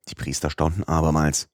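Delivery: ordinary delivery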